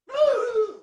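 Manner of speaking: a laugh that jumps up and breaks; real laughing voice crack. Real, not acted. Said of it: "voice crack like I'm fucking going through puberty".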